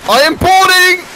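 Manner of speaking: high, loud announcement; battle cry. Real, not acted. Player boarding the enemy ship, high excited battle cry. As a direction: yell it as you charge aboard.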